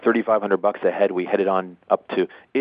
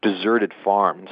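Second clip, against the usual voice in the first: ordinary talk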